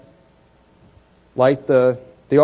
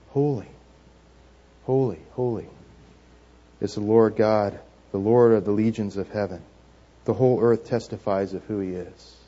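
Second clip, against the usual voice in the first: soft, low, measured recitation; hushed angels' call; 6 semitones lower, 6 dB quieter than usual. Acted. Angels calling out in worship around the throne, hushed and low. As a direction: recite it quietly and reverently, pausing between each holy. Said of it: "They're calling out".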